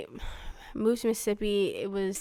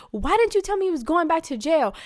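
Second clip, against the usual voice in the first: retold angry demand, pitched high; high, heated mock confrontation; mad outburst; 7 semitones higher, 3 dB louder than usual. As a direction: confront them, voice jumping up. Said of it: "I was just mad".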